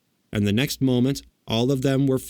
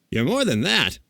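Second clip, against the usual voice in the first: higher, mildly aggrieved; grieved; 6 semitones higher than usual. A narrator's reading. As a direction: reproach him with a touch of hurt. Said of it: "in a grieved tone".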